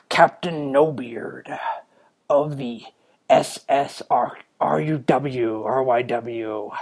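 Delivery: slow, hammed-up pirate captain; Captain No Beard. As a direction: announce yourself as a mock pirate, drawn out. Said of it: "in a pirate's voice".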